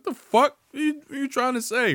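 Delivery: very high, loud indignant squeal; mock indignant woman, very high; offended girl. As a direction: she takes offense.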